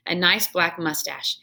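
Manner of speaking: ordinary delivery, nothing marked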